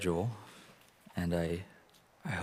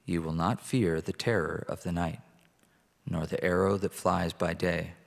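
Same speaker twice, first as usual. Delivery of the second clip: scripture reading. Acted. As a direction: read it out to the congregation. Reads Psalm 91 aloud.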